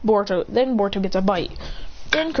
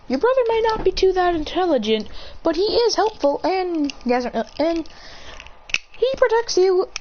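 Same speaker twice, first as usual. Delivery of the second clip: raised, high voice for another character; 8 semitones higher than usual. Retold or imitated. Telling Sarada her brother protects her, high voiced character. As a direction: lift the voice up into a higher character.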